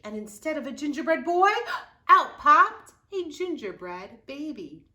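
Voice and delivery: sudden jump to loud, high reveal; building surprise. A narrator's reading.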